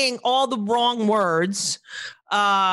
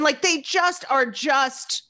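ordinary talk, nothing marked

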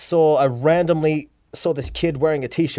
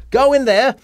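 excited; 6 semitones higher, 4 dB louder, slower than usual. Real, not acted. Excited telling of success.

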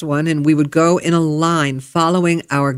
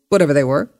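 ordinary talk, nothing marked

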